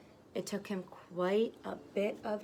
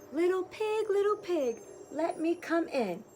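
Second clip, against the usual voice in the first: higher, louder sing-song wolf call; wolf at the door; 10 semitones higher, 4 dB louder than usual. Acted. Wolf calls again.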